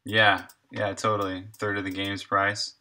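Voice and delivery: ordinary talk, nothing marked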